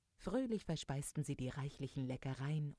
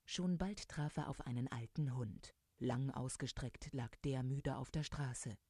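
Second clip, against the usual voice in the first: usual speaking voice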